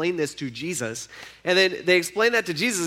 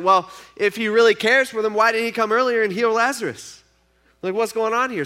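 imitated indignant challenge, louder and higher; mock-indignant, raised voice; skeptical onlookers; 6 semitones higher, 6 dB louder than usual. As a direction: question it like a doubting bystander. Acted skeptical onlookers questioning Jesus.

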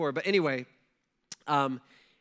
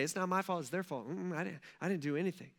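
mock denial; 7 dB quieter, faster than usual. Mocking culture blaming others.

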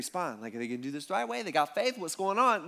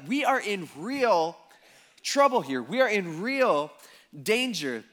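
slow, higher, drawn-out dread; panicked disciples; 4 semitones higher, 3 dB louder, slower than usual. Retold or imitated. Disciples panicking in sinking boat, slow mock dread. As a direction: spell out the danger, half acting the panic. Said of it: "they start to kinda panic".

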